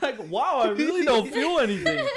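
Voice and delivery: high laughing voice; laughing mock wonder. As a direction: marvel while giggling. Pretending no pain, laughing.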